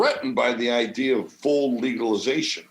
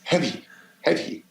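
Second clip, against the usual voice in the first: lightly voiced breezy LA wife; a hint of a picky Los Angeles wife turning it down; a touch of the choosy LA ex-wife; 4 semitones higher than usual. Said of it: "she's, like, so L A".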